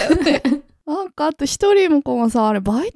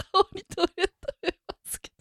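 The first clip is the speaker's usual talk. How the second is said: laughing; 5 semitones higher, 10 dB quieter, much faster than usual. Real, not acted. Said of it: "笑いすぎて".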